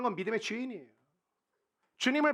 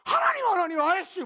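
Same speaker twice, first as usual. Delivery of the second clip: much higher, loud frustrated cry; hammed-up desperate shout; Moses shouting; 10 semitones higher, 7 dB louder than usual. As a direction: yell up at God in exasperation. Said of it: "모세도 소리 지르는 거예요".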